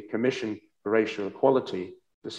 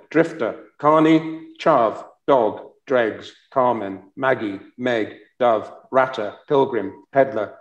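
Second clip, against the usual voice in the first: louder, rhythmic recital with a beat on each word; poem reading; 8 dB louder, slower than usual. Acted. Host reading a poet's list-poem aloud to the audience, rhythmic and emphatic. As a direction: give every name in the list its own weight.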